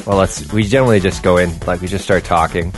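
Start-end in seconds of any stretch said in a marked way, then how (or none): none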